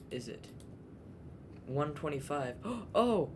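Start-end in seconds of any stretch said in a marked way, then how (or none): 2.6-3.3 surprised gasp. Real, not acted. Sudden gasp at time.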